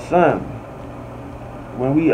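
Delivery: normal talking voice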